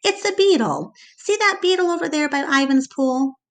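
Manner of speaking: put-on like a girl who just solved a puzzle; Julia's excited discovery, pointing it out. A narrator's reading. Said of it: "she exclaims".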